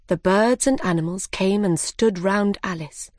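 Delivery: ordinary delivery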